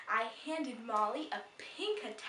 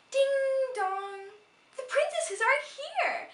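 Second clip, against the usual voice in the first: sing-song greeting; 14 semitones higher, 9 dB louder, slower than usual. Acted. Greeting guests at the door.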